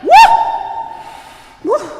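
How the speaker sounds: loud whooped "woo"; dizzy whoop. Acted. Martina reeling from the rooster's noise, dizzy whoops. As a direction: let out two short, loud whoops.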